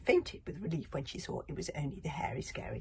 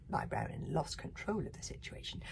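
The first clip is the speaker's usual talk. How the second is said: plain reading voice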